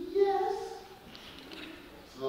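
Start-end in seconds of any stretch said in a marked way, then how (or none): 0.1-0.5 put-on tiny sweet boy calling out; pretty little voice. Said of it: "with his pretty little voice".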